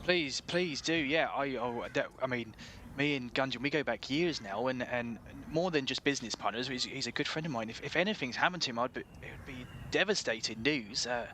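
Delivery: earnest West Country shopkeeper; worried, halting West Country pleading; West Country accent. Acted. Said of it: "best West Country accent this is".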